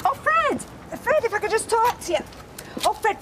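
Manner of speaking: high-pitched, urgent appeal; high, insistent entreaty; urgent pleading. Acted. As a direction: plead to be heard, voice pitched right up. Pleading with Fred to listen.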